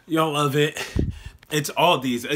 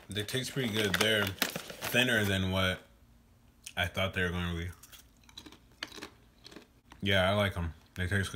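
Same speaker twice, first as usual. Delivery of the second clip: talking with mouth full; crunching between words; chewing chips while talking; 5 semitones lower, 6 dB quieter than usual. Real, not acted. Seen 0: Bites chips at lips 4.0–8.2; chewing after earlier bite.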